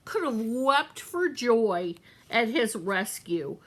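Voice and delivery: ordinary delivery, nothing marked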